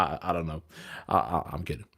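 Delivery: usual speaking voice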